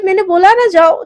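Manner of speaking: loud, high shouted order; angry shout. Acted. Boss shouting at the assistant to leave, loud and high. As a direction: shout her out.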